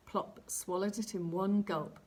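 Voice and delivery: usual speaking voice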